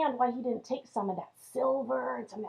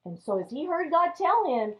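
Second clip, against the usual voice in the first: ordinary delivery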